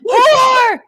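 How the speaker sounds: high, loud hammed-up ranting; mock furious yelling. Comic playing a raging man who yells at a woman. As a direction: scream the insults like a raging man.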